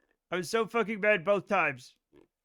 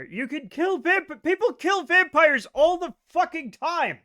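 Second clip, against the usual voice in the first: higher, louder exasperated protest; indignant; 8 semitones higher, 7 dB louder than usual. Real, not acted. Arguing that people kill vampires, a loud indignant protest. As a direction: push back, incredulous and loud.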